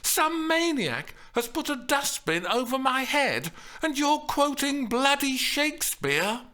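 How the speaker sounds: high-pitched indignant rant; outraged, rising to a question; incredulous outrage. A narrator's reading. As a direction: rail at him in disbelief. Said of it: "she shouted incredulously".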